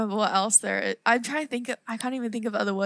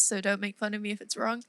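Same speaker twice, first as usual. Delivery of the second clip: ordinary delivery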